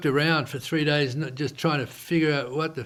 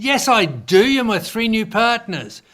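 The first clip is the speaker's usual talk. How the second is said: raised, heated retelling of his protest; insisting on the phone to his partner, heated and loud; indignant insistence; 6 semitones higher, 5 dB louder than usual. Retold or imitated. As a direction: push back hard, voice up and louder.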